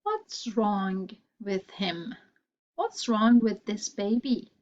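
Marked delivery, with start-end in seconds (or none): none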